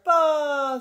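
loud, high drawn-out bleat; calf bawling. A narrator's reading. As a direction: bawl like a calf. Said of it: "said Bright".

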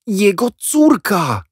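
high, loud, slow announcement; triumphant reveal. A narrator's reading. Jack revealing to the boys, high loud and drawn out. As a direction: drop the big secret. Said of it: "powiedział".

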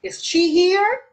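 yes-no question climbing high on "here"; "here" swept upward as a question; rising intonation. Shown as a demonstration. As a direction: let "here" lift at the end. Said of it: "the correct answer is rising intonation".

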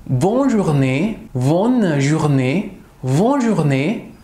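bonne journée drawn out very slowly; very slow bonne journée, bonne soirée; slow demo. Shown as a demonstration. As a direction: stretch each syllable for learners. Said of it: "Por ejemplo".

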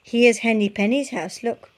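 ordinary delivery, nothing marked

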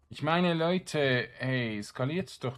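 slow, loud, high plea to the viewers; real pleading to the audience; exasperated appeal. Real, not acted. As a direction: drag it out in disbelief.

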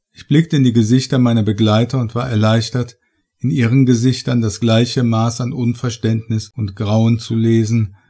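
ordinary delivery

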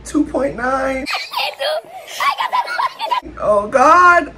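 loud laughing cry; half scream half laugh; laughing panic. Real, not acted. Vlogger counting down to eat frog, laughing panic.

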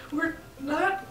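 high, loud, drawn-out retort; mock indignant refusal. Friends answering the car driver's order, indignant mock refusal. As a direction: refuse slowly and emphatically, pitch way up.

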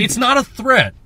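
high, loud indignant protest; mock angry. Man angry at people banning the word, mock outrage. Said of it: "me being angry at them".

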